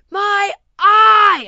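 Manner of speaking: high, loud anguished yell; full-throated high scream; screaming teenager. Retold or imitated. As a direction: scream it like a wasted, panicking teen. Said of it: "comes over screaming ... so the teenager is like".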